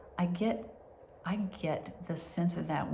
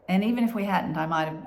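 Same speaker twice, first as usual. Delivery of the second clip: ordinary talk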